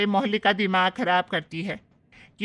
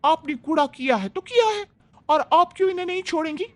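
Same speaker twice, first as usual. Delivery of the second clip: put-on higher, bright-eyed young bride defending her mother-in-law; young daughter-in-law; 9 semitones higher than usual. Young bride tells off the neighbour Lata, higher and defiant. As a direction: stand up loyally for your mother-in-law, voice higher.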